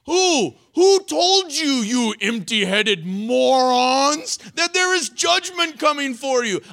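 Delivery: high, loud mocking taunt; mocking Jesus. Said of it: "He's like".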